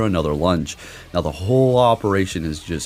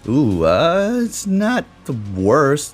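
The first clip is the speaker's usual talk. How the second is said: surprised; 9 semitones higher, 3 dB louder, much slower than usual. Real, not acted. Reacting to opponent's lead.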